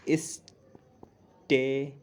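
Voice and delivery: stretched out syllable by syllable; slow spelled-out demo. Shown as a demonstration. Teacher spelling a word slowly for the class. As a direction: draw the word out very slowly for students. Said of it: "एक होता है stationary".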